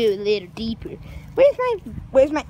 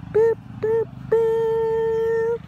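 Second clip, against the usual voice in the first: playful beeping noise; cute cartoon beeps; high little boops; 8 semitones higher, much slower than usual. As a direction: boop in a high, held tone. Playful sound effect.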